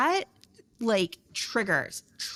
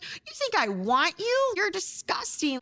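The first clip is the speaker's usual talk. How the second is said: high, drawn-out mock contempt; irate; 10 semitones higher than usual. Wife rejecting her ex while hitting him, irate. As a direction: act out disgusted rage up high. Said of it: "just irate".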